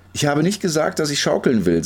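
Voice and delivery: indignant protest. A narrator's reading. Girl tells the pusher she never asked, protesting.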